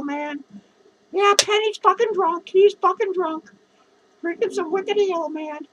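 normal talking voice

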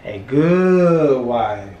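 slow, loud sing-song tease; drawn-out teasing. Pretend. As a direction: stretch each word to tease her.

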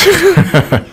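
high chuckling laugh; short laugh. Real, not acted. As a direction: chuckle after the aside. Laughs about the loud neighbors.

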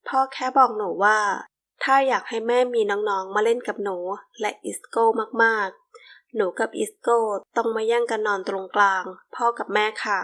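usual speaking voice

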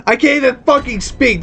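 ordinary delivery

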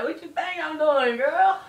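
high, loud mock-indignant comeback; exaggerated incredulous retort. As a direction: throw it back loudly, voice shooting up. Retold phone talk, indignant retort.

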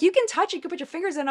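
light imitation of cheerful staff; high mock staff voice. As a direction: imitate the team urging her on. Retells staff inviting her.

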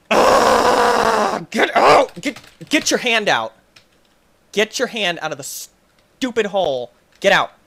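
loud, high yelling at the game; furious yelling. Real, not acted. Gamer yelling at a stuck hand, loud and furious. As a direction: shout it like the hand won't move.

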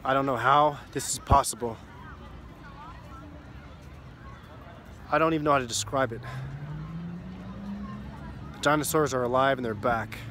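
low, quiet and grave; hushed final message. Acted. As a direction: speak like a last desperate recording. Acted final message recording.

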